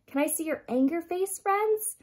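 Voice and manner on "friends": plain reading voice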